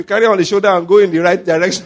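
ordinary delivery

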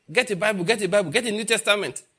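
urgent telling. Retold or imitated. Convert urging his friends.

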